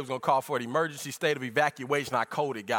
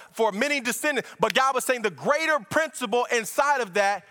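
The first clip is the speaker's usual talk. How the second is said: higher, driving preacher's voice; fervent preaching; 9 semitones higher, 5 dB louder than usual. Acted. Preacher explaining circumcision's principle to church, fervent preaching. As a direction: preach it in sustained, raised phrases.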